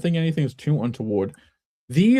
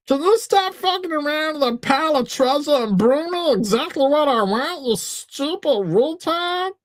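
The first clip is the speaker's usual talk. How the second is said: very high, complaining cartoon voice; Meatwad; 12 semitones higher than usual. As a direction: scold in a squeaky put-on voice. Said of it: "So he's not very happy".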